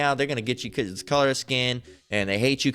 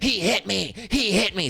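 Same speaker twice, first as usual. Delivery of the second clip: much higher mock victim's wail; mock hysterical accuser; 8 semitones higher than usual. Woman falsely accusing a man to police, mock hysteria. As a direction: play up a fake victim's alarm.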